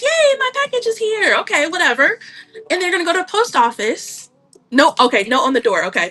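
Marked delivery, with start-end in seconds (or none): 0.0-1.4 high, squealing mock excitement; mock-thrilled customer cheering over a package; excited customer. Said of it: "gonna be super excited, like".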